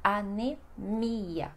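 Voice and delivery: "anemia" slowly as a-ne-MI-a; Portuguese pronunciation. Shown as a demonstration. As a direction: draw out the stressed MI in "anemia". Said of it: "Mais forte".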